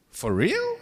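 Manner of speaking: high, loud disbelieving squeak; mock amazed shriek; incredulous for real. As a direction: play the stunned customer who never heard of Africa. American customer amazed Ghana exists.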